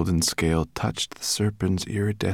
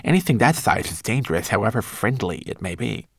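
quick, raised fretful grumble; muttered grumble; 4 semitones higher, 3 dB louder than usual. A narrator's reading. Twigleg digs back into the bag, grumbling. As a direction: fret it out fast, unconvinced. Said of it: "muttered Twigleg".

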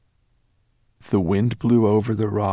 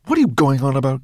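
loud, higher irritated grumble; grumbling dragon; 6 semitones higher, 8 dB louder, much faster than usual. A narrator's reading. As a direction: grumble impatiently at a servant, voice raised. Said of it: "grumbled Nettlebrand".

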